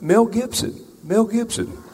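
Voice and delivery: high, eager squeal of a starstruck fan; high-pitched imitation of a starstruck tourist; excited fan. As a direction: call it out up high like spotting a star.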